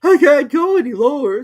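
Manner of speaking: high, loud protest; high, loud Hatter; pitiful Hatter. A narrator's reading. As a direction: plead from the floor. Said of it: "said the Hatter".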